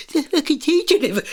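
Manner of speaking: half-finished mock protest; retold swearing class. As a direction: imitate a class's indignant complaint. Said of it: "they're all swearing".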